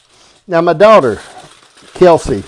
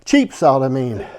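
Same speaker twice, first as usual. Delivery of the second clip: ordinary talk, nothing marked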